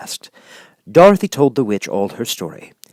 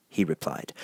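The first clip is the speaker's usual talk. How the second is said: ordinary delivery, nothing marked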